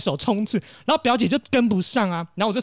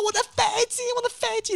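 panicked; faster than usual. Retold or imitated. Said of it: "在紧张的时刻，你根本没有办法说话很正常的讲话".

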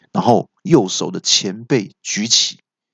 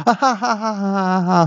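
high, long cackling laugh; villain laugh; 10 semitones higher than usual. A narrator's reading. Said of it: "恶怪听了就大叫说". (恶人得意高声狂笑)

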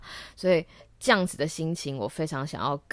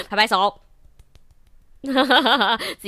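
laughing; 9 semitones higher, 8 dB louder, much slower than usual. Real, not acted. Laughs, claps for herself.

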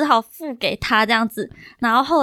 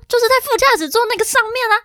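又急又快，音调拔高; 急得连珠炮似的说出来; 着急地说; 10 semitones higher, 3 dB louder, faster than usual. Retold or imitated. 急着跟朋友说手机在车上. (跟朋友着急解释手机落在副驾驶座，语速快)

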